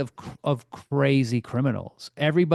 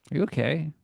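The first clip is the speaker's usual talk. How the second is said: ordinary talk, nothing marked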